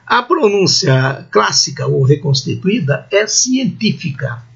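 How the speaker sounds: ordinary delivery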